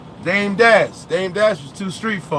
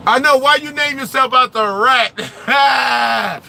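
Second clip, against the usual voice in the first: laughing; 7 semitones higher, 5 dB louder, slower than usual. Real, not acted. Laughing mock challenge at friend.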